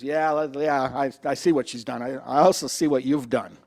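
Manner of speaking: low, calm, measured reply; calm Jesus. Acted. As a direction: answer gently but pointedly. Jesus answers the crowd calmly.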